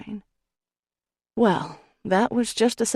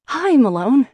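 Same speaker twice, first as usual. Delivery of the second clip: bright raised call across the house; eager call to the doorway, pitched up; 7 semitones higher, 8 dB louder than usual. Acted. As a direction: call out to him as he comes in. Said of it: "I call".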